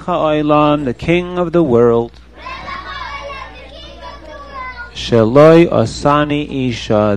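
slow, reverent prayer reading; blessing recitation. Acted.

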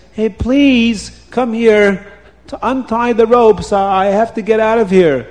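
high, loud plea; urgent pleading. A narrator's reading. Tied-up man begging the passing wife for help, pleading. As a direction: call her over, begging urgently.

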